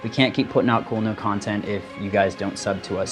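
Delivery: ordinary talk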